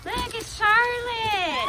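slow, high, loud announcing call; excited shout. Real, not acted. To the dogs, introducing the new puppy, drawn-out excited call. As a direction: proudly show off the new puppy.

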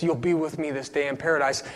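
very soft, low promise; hushed assurance. Retold or imitated. Torn Jesus assuring the thief beside him, hushed. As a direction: drop right down to a near-murmur.

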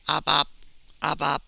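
low, loud, short croaks; punchy low pairs of frog calls. A narrator's reading. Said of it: "A bright green frog".

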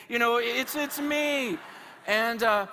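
ordinary delivery

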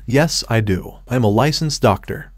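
ordinary delivery

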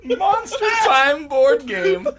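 high, slow, through helpless laughter; cracking up; laughing hard. Real, not acted. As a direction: barely get it out for laughing. Cracking up reading silly answer.

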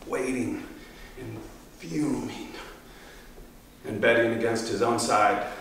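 low, soft, pause-laden storytelling; hushed story narration. A narrator's reading. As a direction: let the tale sink into quiet sorrow.

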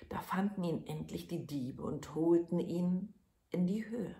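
plain reading voice

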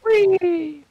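excited squeal. Real, not acted. Jumping in the game.

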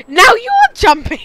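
loud, high, drawn-out cry; mock exclamation. Player reacting to jumping characters, loud high exclamation. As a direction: shout it high and slow.

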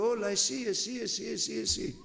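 high, rapturous repeated "εσύ"; high, fervent chant-like "εσύ, εσύ"; ecstatic prayer. Retold or imitated. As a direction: lose yourself in praise. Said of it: "Αρχίζω και προσεύχομαι".

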